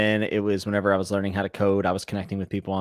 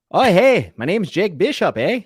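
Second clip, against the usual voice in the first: loud, high, hammed-up Canadian accent; 9 semitones higher, 6 dB louder, slower than usual. Shown as a demonstration. Streamer obliging a viewer's request, hammed-up Canadian accent. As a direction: do an exaggerated Canadian accent, pitched up. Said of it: "Give me your best Canadian accent".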